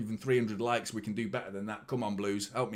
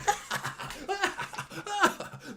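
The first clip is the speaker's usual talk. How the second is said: high, manic cackle; insane laugh. Retold or imitated. Fan acting out going mad over eleven straight losses, manic high laugh. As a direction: cackle like you've lost your mind. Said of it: "laughing like an insane person".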